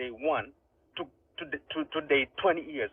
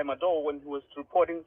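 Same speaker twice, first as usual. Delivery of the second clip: ordinary delivery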